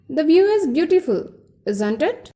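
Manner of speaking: "beautiful" falls, then "isn't it" lifts; rising question tag. Shown as a demonstration. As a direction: raise the pitch at the end of "isn't it". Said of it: "Some more questions".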